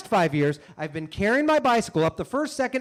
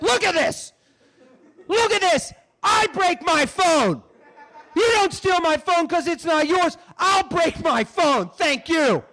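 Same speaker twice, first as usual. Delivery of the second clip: higher, louder mocking shout; gloating shout; 9 semitones higher, 4 dB louder than usual. Imagined taunting of the phone thief, loud gloating shout.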